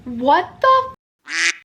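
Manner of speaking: shocked disbelief. Retold or imitated. Reacting to Ted refusing to leave, slow stunned disbelief. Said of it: "I'm like".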